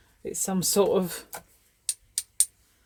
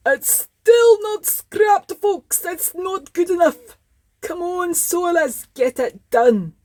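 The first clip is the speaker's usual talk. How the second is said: high, loud, indignant Scottish character; Scottish accent; 8 semitones higher, 7 dB louder, slower than usual. Acted. Little Brassy nags that the caravan is still not scrapped, Scottish accent. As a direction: plead and scold in a Scottish accent. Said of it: "I'm talking in a Scottish accent".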